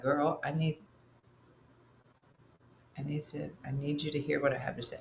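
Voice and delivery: low, soft serious voice. Retold or imitated. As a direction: drop into a grave talk voice. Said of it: "does the tone change?".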